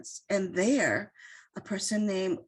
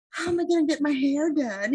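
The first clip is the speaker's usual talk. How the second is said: higher, louder, hammed-up city-girl fretting; 7 semitones higher, 5 dB louder than usual. City girl protesting a rustic camping trip, mock dismay. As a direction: play up a city girl's dismay. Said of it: "you know, the city girl".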